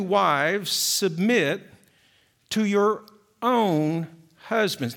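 slow read with "own" held long and falling; stress on own. Shown as a demonstration. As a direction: lean hard on the word "own". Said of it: "let me emphasize that word own".

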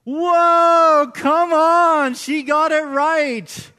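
real thrill, stretching out the last words slowly; excited shout. Real, not acted. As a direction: cheer her on like a game show host. Pastor reacts to correct Jeopardy answer.

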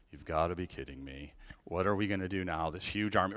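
low, deflated disbelief; exasperated Gideon. Retold or imitated. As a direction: groan it out like a beaten man.

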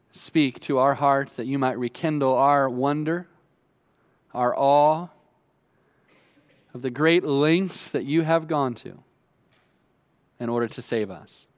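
ordinary talk, nothing marked

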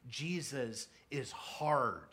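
very slow, heavy on hard; slow weighty emphasis. Real, not acted. Preacher tells the congregation Jesus is hard, slow weighty emphasis. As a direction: let hard land slowly and heavily. Preacher drives home the point.

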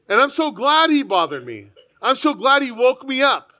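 ordinary talk

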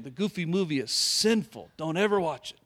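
ordinary talk

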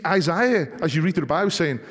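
usual speaking voice

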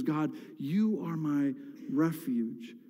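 hushed, slow, reverent; tender declaration. Retold or imitated. Pastor voicing David quietly declaring God his refuge, tender. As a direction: softly confess your trust.

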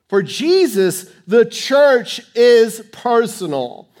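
fervent preacher's climax; preaching at full force. Real, not acted. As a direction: drive the point home to the congregation.

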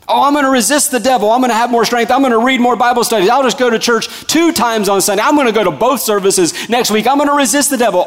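high, rushed mock determination; overeager resolve. Believer vowing to resist alone, overeager. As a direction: rattle off vows in a high, eager voice.

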